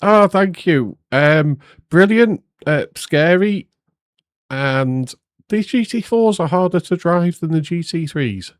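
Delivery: nervy thrilled answer to the interviewer; excited. Real, not acted. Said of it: "post-race interview".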